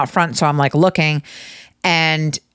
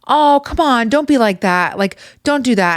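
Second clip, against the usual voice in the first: higher-pitched, whiny brush-off; lightly acted wheedling, pitch up; mock cajoling coworker; 7 semitones higher than usual. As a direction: imitate someone pleading to let it go.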